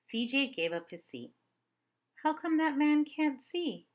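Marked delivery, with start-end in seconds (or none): 2.2-3.9 put-on curious child. Child asks about blind man.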